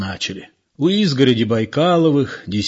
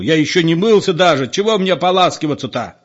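raised, higher-pitched indignant protest; yelling; 6 semitones higher, 4 dB louder, faster than usual. A narrator's reading. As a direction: keep hollering in protest. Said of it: "заорал спокойный Петро".

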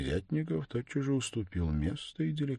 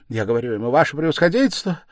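fast, loud, higher delirious cry; delirious outcry; 9 semitones higher, 12 dB louder, much faster than usual. Acted. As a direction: blurt it out gasping in fever. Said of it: "выкрикивала она, отдыхиваясь после каждого слова".

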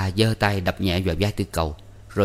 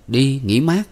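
questioning echo rising on "mát"; puzzled echo; 6 semitones higher, 4 dB louder, slower than usual. A narrator's reading. Tư Cầu echoes the boy's words, puzzled. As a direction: ask it back with a raised, puzzled lift on "mát".

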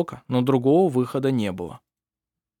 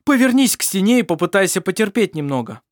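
loud, raised voice over a howl; shouting; 7 semitones higher, 5 dB louder than usual. A narrator's reading. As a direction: call out over the noise. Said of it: "перекрывая своим голосом полузадушенный вой". (громко, перекрикивая)

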